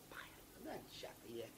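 unvoiced, hushed curse; whispered curse. Master whispers a curse back to the attendant. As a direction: hiss it under breath.

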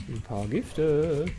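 slow, savoured echo of Gifte; drawn-out repeat. Shown as a demonstration. Streamer slowly relishing the poison hit, drawn out. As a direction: stretch each word out, relishing it. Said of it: "slow mocking repeat".

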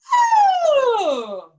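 loud high oh gliding down; sliding oh. Shown as a demonstration. Teacher demonstrates the acorn voice slide again. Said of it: "it's like this".

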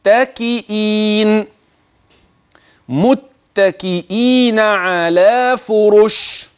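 slow recited متكئين على فرش with long vowels; Quran recitation. Shown as a demonstration. Teacher reciting the next verse for students, slow and measured. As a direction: chant متكئين slowly, as in tajweed. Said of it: "انتبه معي لما سيأتي".

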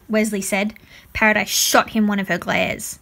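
usual speaking voice

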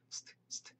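whispered "st" ending, no vowel; light unvoiced st. Shown as a demonstration. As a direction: let 스트 be only hiss and tap. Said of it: "약간 이렇게 스트".

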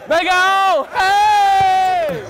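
loud excited cheer; cheering shout. Real, not acted. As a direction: yell out in triumph. Cheering a teammate.

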